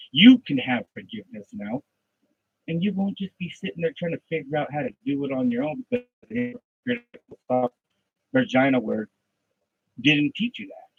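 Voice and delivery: loud, driving sermon voice; loud revival preacher, rising and hammering; preaching at full force. Acted. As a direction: preach it hard and loud to the pews. Preaching to an imagined congregation.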